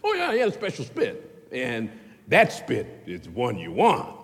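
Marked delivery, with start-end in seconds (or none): 0.0-1.5 high mocking sarcasm; sarcastic. Preacher mocking the special-spit theory, high sarcastic. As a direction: scoff at the idea.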